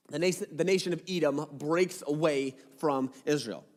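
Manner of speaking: ordinary delivery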